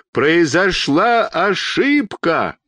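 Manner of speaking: slow, high long-distance call; straining cry. A narrator's reading. Doctor cupping hands to call up, straining cry. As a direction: stretch every word, calling high. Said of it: "закричал изо всех сил".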